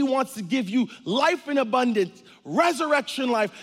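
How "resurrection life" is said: ordinary delivery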